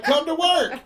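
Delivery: high, loud mock order; mock bossy boyfriend. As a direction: imitate an exasperated boyfriend's command. Boyfriend orders her in retelling.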